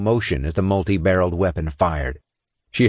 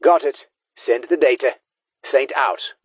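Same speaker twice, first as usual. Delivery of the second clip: lightly voiced squad leader; a hint of a tough officer; commander voice; 6 semitones higher than usual. A narrator's reading.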